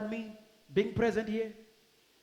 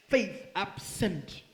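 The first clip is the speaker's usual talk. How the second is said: normal talking voice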